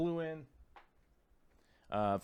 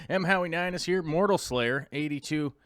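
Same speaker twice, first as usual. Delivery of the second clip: ordinary delivery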